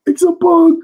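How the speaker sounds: gasp, then a very high alarmed squeal; startled Usopp. Retold or imitated. Usopp spotting the bug, gasping at a very high pitch. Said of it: "he was like".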